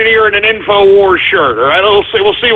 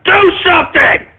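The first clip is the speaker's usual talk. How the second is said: frantic yelling; 8 semitones higher than usual. Acted. Host yelling at the engineer.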